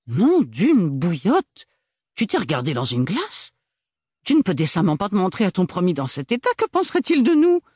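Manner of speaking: high, loud, scandalised outburst; horrified at her scruffy sister; appalled sister. Acted. Agathe shocked at Ophélie's appearance, loud.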